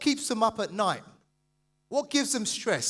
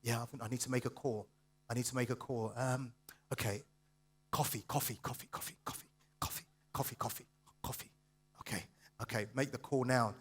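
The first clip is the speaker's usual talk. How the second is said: low, hushed muttering to oneself; quiet, half-whispered nervous self-talk; acting out procrastinator; 9 semitones lower, 10 dB quieter, faster than usual. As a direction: talk yourself into it under your breath. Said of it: "It's like this".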